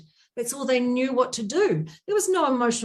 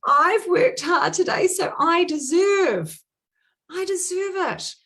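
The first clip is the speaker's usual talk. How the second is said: higher, insistent excuse; self-justifying; 6 semitones higher, 4 dB louder than usual. Acted. Coach voices a client excusing a treat to herself, self-justifying. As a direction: drag out "deserve" like you've earned it. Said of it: "the story you tell yourself".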